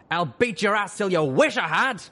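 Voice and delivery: loud, high, furious threat; angry threat. Acted. Father threatening.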